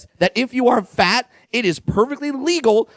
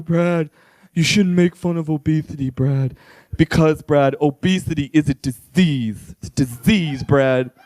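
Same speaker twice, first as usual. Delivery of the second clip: lower, slow preachy scold; lecturing audience member; 7 semitones lower, slower than usual. Acted. Offended audience member scolding Brad after the show, low preachy mock lecture. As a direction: wag a finger and lecture him. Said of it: "Don't come up to me afterwards".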